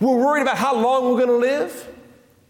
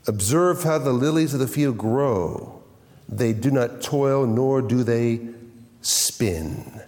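scripture reading; 11 semitones lower, slower than usual. Acted. Quotes Christ on lilies.

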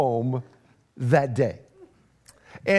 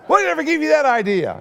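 higher, louder and quick, fake outrage; mock indignant retort; 10 semitones higher, 5 dB louder, faster than usual. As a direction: fire it back defensively. Retold angry person's retort.

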